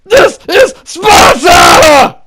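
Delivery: huge bellowed movie war cry; mock epic battle shout; Sparta yell. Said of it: "I had to add that".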